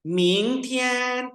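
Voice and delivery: slow, high Mandarin model reading; Chinese demo. Shown as a demonstration. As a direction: sound out each syllable for the class. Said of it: "sentence क्या है वो देखते हैं".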